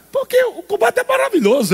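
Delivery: high, bright burst of joy; joyful exclamation. Real, not acted. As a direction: exclaim how wonderful it is. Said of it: "preaching delight".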